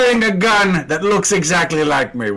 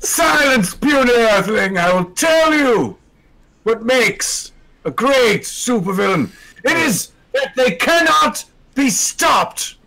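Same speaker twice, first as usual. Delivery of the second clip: dark lord; 6 semitones higher than usual. Acted. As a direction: declaim like a conquering alien. Villain speech about supervillains.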